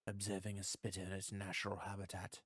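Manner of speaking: hushed, soft nature film narrator; nature documentary. Pretend. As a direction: murmur like a wildlife documentary host. Game vlog parodying nature film.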